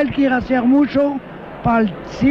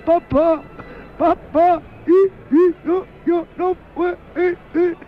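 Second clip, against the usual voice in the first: high, halting imitation of a sobbing little boy; crying small child; 5 semitones higher than usual. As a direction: mimic a child sobbing out broken words. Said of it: "entonces estaba llorando".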